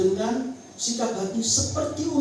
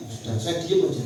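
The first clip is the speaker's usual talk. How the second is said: normal talking voice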